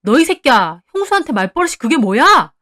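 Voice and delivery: loud, furious rebuke of a younger brother; angry shout. A narrator's reading. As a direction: yell it as an outraged scolding. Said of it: "남편도 소리 질렀습니다".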